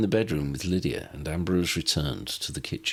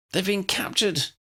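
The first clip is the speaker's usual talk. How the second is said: breathless; 12 semitones higher than usual. A narrator's reading. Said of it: "Lydia announced breathlessly".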